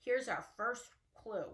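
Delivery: ordinary talk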